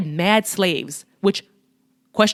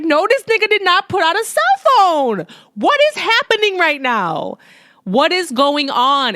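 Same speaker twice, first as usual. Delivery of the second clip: much higher, louder, shocked disbelief, slowing at the end; incredulous; 9 semitones higher, 3 dB louder than usual. Retold or imitated. Speaker reliving her shock at the movie scene, incredulous. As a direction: react like you just saw something impossible. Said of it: "I'm like".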